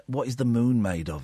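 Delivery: ordinary delivery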